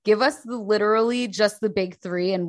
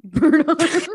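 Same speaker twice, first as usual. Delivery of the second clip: laughing disbelief, higher and louder; 10 semitones higher, 5 dB louder than usual. Real, not acted. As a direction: repeat it through a laugh. Echoing a silly band name.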